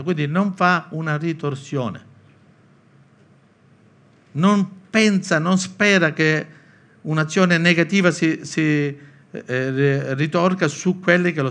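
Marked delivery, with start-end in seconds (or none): none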